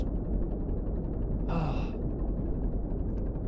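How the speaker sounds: long weary exhale; long sigh. Real, not acted. Gamer sighing at the dripping hull. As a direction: breathe out tired and resigned.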